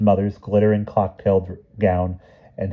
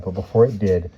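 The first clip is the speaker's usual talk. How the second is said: usual speaking voice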